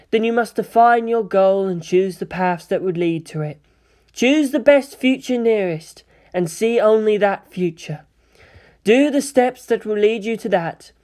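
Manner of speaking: measured, emphatic seer's counsel; deliberate woman mentor with authority; commanding female voice. Acted. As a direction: instruct him like a lofty oracle. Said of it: "he heard a commanding female voice say".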